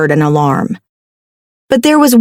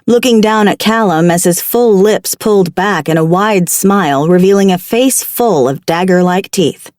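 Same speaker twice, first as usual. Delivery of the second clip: plain reading voice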